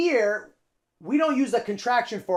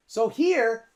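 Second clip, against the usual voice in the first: ordinary delivery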